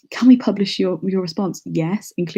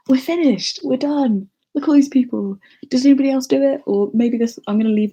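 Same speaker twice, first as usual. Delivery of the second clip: excited; 6 semitones higher, 5 dB louder than usual. Real, not acted. Survey finally submitted, celebrating.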